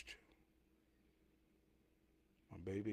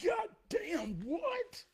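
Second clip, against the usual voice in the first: squeaky, very high disbelieving yelp; shocked neighbour; 6 dB louder, much slower than usual. Retold or imitated. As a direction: hit a sudden falsetto of disbelief as the neighbour. Neighbour's shocked outburst retold.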